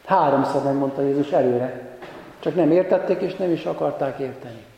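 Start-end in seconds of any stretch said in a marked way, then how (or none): none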